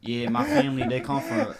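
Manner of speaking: put-on high-pitched Polish accent; hammed-up Polish accent voice. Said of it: "Do a Polish accent".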